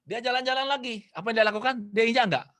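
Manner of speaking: ordinary talk, nothing marked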